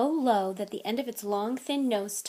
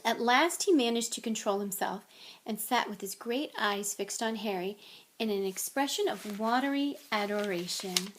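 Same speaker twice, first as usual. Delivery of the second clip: usual speaking voice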